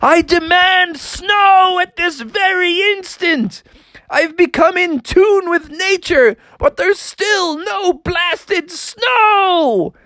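high, slow frog shout at the sky; high indignant frog yelling; demanding shout. Acted. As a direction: demand snow from the heavens. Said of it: "started shouting louder and louder".

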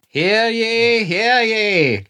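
high, ringing town crier call; stern archaic proclamation. Pretend. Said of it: "The crier has left the streets".

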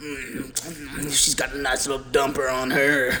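low leering creep voice; lightly voiced lecherous stepdad; quoting the stepdad, leering low; leering stepdad impression. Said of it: "and I quote".

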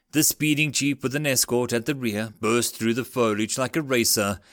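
plain reading voice

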